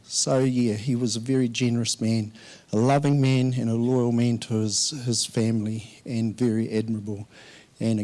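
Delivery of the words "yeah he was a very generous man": normal talking voice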